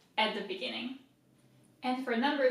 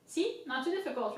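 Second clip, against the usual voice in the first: ordinary delivery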